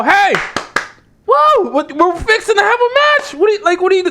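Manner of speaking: high-pitched mock yell of alarm; imitated shouting. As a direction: yell like exasperated teammates waking someone up. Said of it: "clapping their hands and shouting at her".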